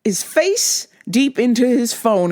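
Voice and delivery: ordinary delivery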